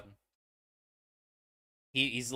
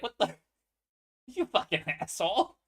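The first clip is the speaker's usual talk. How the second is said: angry retort; 7 semitones higher, 4 dB louder than usual. Retold or imitated. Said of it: "And then he gets in like".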